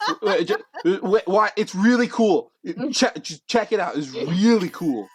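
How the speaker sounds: high, loud, stammering insistence; flustered pleading. Acted. Dex defending his word to judges, flustered high plea. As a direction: insist desperately, stumbling over words.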